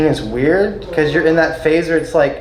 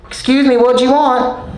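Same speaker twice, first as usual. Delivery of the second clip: higher, impatient cashier asking what he wants; 9 semitones higher, 4 dB louder than usual. Retold or imitated. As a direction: play the gas station clerk, pitched up and curt. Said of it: "and she was like".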